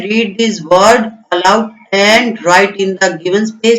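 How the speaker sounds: very slow, word by word; reading aloud slowly. Shown as a demonstration. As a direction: read each word out slowly for the kids. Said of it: "आपको क्या करना है?". (बहुत धीरे, एक-एक शब्द)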